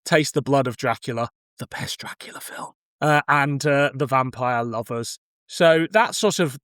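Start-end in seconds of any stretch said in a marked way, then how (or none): 1.6-2.7 breathy whisper, almost voiceless, dropped well under the talk; whispered aside. Real, not acted. Host whispers his verdict on the best Dracula film as a secret aside. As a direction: slip it in as a hushed private aside.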